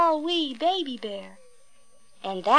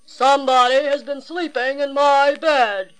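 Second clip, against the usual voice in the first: great big voice; 3 semitones higher, 8 dB louder than usual. A narrator's reading. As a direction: boom it out like a huge gruff father bear. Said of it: "in his great rough, gruff voice".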